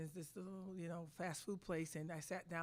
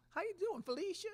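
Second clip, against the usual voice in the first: imitating a Southern accent, pitched much higher and louder; Pat Summitt's Southern accent; 13 semitones higher, 4 dB louder than usual. Coach imitating Pat Summitt greeting her with a Southern accent. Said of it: "It was a beautiful Southern accent".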